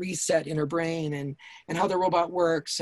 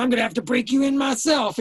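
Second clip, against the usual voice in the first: hammed-up menacing pimp; 7 semitones higher, 4 dB louder than usual. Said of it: "He's going".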